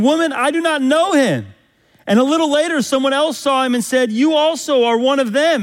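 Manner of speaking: loud, high preaching voice; impassioned preacher reading Peter's denial; preaching at full force. Acted. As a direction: bring the scripture home with fervour.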